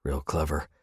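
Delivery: ordinary delivery, nothing marked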